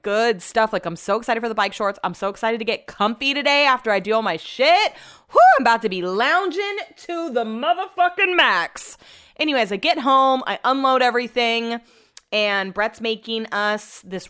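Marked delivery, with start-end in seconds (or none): 5.3-9.0 gleeful hype. Real, not acted. Telling listeners about lounging all day, gleeful hype. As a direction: whoop it up with swooping pitch.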